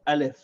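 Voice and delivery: Alif said clearly, stress on first syllable; Arabic letter name. Shown as a demonstration. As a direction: say Alif slowly and clearly: A-lif. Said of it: "The name of this letter is".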